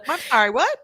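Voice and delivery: laughing through the words. Real, not acted. Breaking into laughter at own bit, laughing question. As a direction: crack up mid-question.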